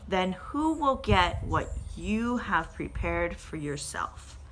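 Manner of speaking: slow, weighty Bible reading with the voices acted; measured, earnest reading aloud; dramatic scripture reading. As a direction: read the parable like a story to the church. Reads the Luke parable aloud.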